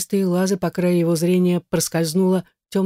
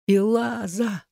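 high, drawn-out cry; choking out a name; 5 semitones higher, much slower than usual. A narrator's reading. Igar chokes out Elaza's name, high strained cry. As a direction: call the name high, without volume. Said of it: "он хрипел, захлёбывался её именем".

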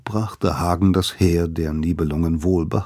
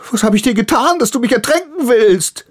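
high, loud furious yelling; holy rage; 5 dB louder than usual. A narrator's reading. Soaked priest yells after Hagen in holy fury. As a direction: scream at him in righteous fury. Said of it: "schickte Hagen seinen heiligen Zorn hinterher".

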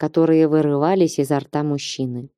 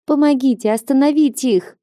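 put-on girl's mild cry; 8 semitones higher than usual. A narrator's reading. Girl begging onlookers to stop a fight, crying out. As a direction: beg urgently in a raised pitch. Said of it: "закричала девчонка".

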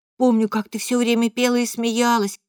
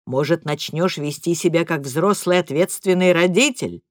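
lower, sarcastic retort; father mocking mother's complaint, low; low cold father; 4 semitones lower than usual. A narrator's reading. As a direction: throw her words back.

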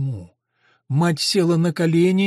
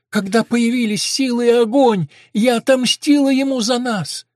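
high, loud, impassioned; raised voice of a mother avenging herself; fervent confession; 7 semitones higher, 5 dB louder than usual. A narrator's reading. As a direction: declare it with heated intensity. Mad mother boasts of revenge.

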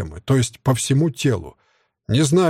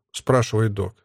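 usual speaking voice

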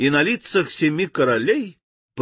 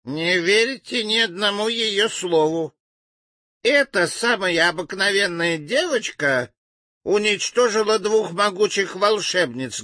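sneering, mocking jab; snide voice; 6 semitones higher than usual. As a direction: sneer it out spitefully. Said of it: "послышался ехидный голос".

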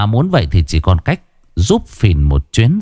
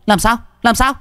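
much higher, fast, shrill defiance; defiant shriek; faster than usual. Acted. Wife admitting her lover to her husband, much higher defiant taunt. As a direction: throw the truth in his face.

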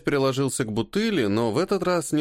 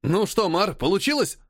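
eager; 7 semitones higher than usual. Acted. Guest bursts in like whirlwind.

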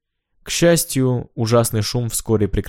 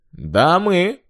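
higher, rising challenge; defiant; 9 semitones higher than usual. A narrator's reading. Hanna answers Cox with a challenge, defiant. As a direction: throw it back as a challenge. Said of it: "с вызовом в голосе".

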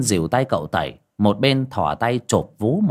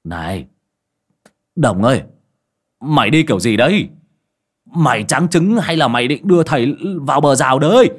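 put-on louder drunken grumbling; drunken slur; 3 semitones higher, 6 dB louder than usual. A narrator's reading. Drunk master scolding his rider on the bike, louder slurred grumble. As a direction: complain like a tipsy master. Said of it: "mà lè nhè".

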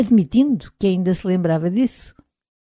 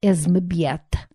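understated displeasure; a touch of sour resignation; disgruntled; 4 dB quieter than usual. A narrator's reading. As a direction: say it with quiet disappointment. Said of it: "disse desgostoso".

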